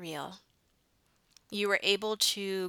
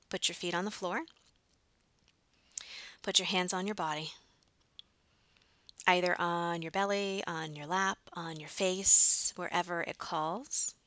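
calm grounding exercise; 3 dB quieter than usual. Acted.

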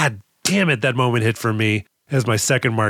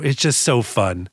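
usual speaking voice

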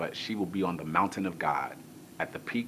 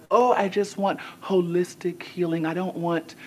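dreamy wife; 10 semitones higher, 5 dB louder than usual. Retold or imitated. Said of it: "And Anna was like".

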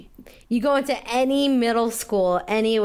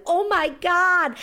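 mock shocked girls; 8 semitones higher, slower than usual. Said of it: "The girls were going".

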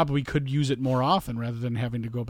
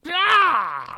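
a high, loud wordless "Ah!"; mock victory yell; 5 dB louder than usual. Mocking a celebrity's victory yell. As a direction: yell like the overexcited athlete.

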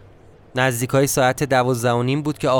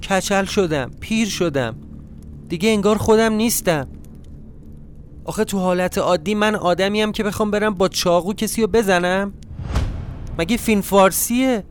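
higher-pitched, plaintive questioning; choked-up self-pity; 6 semitones higher than usual. A narrator's reading. Narrator asking himself what this house did to him, plaintive self-pity. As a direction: lament to yourself in a raised pitch, mildly choked up. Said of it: "اون شب همش بغض داشتم".

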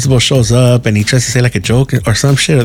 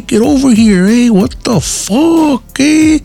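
tough homie voice; 12 semitones higher, much slower than usual. Retold or imitated. [name] teasing how he'd talk to the kids, with a high, slow homie voice. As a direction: lay it on like a rowdy homie yelling at kids. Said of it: "I fucking talk like that".

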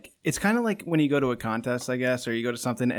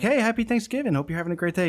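bright, much higher "happy Thanksgiving"; cheery greeting; 9 semitones higher, 3 dB louder than usual. Retold or imitated. Driver wishing the gate guard happy Thanksgiving, chipper and high. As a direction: chirp it like an overly nice passerby. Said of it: "so I'm like".

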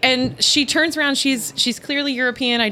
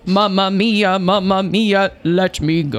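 mock Italian cabbie; 5 semitones lower, slower than usual. Cab driver setting off with tourists, hammed-up Italian. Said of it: "he goes".

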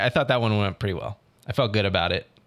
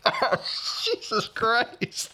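laughing exasperation; 11 semitones higher, much slower than usual. Retold or imitated. Retold reaction to director, laughing.

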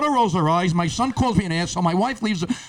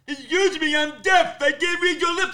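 deaf man's voice; 9 semitones higher than usual. Acted. Deaf pharmacist explains he can't read lips, imitated voice. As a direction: put on a high, mock deaf accent. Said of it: "I'm not making this up".

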